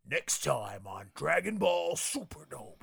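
slow, lightly voiced anime narrator; a hint of the Dragon Ball preview voice; Dragon Ball announcer voice. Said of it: "do your Dragon Ball voice".

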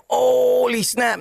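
long drawn-out amazed "holy"; mock shock. Girlfriend watching the aunt hit her kid, mock shock. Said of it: "Shorty's like".

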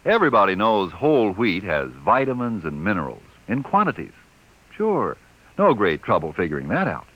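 upbeat radio commercial pitch; ad read. Acted. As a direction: sell the cereal brightly.

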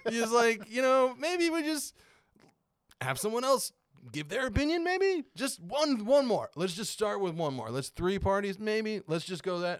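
high-pitched, tentative plea; mock pleading. Acts out a timid plea.

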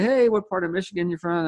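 high, stretched friendly call-out; eager greeting. Acted. Traveler greets someone in a Michigan T-shirt, eager and high.

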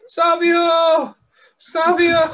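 high, loud laughing shout; mock fan yell. Co-host joining in with a high laughing yell. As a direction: scream the name like a fan.

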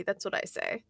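whispered, conspiratorial sign-off; hushed tag line after the rant; whispered aside. Real, not acted. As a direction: drop to a whisper for the last word. Aside after "Justice for Judy.

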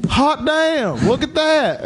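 high, amazed coach holler; Coach McCullough. Retold or imitated. Coach sees the mess on the drain, high amazed coach voice. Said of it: "And Coach McCullough goes".

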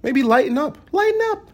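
high squeaky mimicry; mocking advice. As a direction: parrot the advice in falsetto. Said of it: "The recommendation was that".

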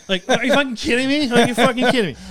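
loud, high, hammed-up indignation; outraged disbelief. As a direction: retell it as an exasperated outburst. Retold outraged reaction in court.

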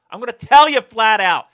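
yelling. Real, not acted. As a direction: yell it out as a rant. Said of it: "Old man yells at tree".

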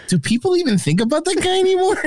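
high-pitched, baffled question; incredulous question. Acted. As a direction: ask in mock bafflement, pitch climbing high.

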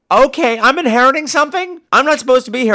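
high, puzzled protest; confused protest. Retold or imitated. Maggie realizing she is in the will, confused.

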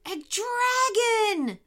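high, drawn-out cry of alarm; terrified scream. A narrator's reading. Lead dingo fleeing the roar, terrified scream. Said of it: "screamed the lead dingo".

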